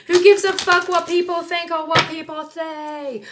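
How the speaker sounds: high, loud exasperated rant; aggressive outburst. Pretend. Reader dismissing what people think, aggressive outburst. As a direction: throw the question out high and loud. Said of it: "I'm very aggressive today".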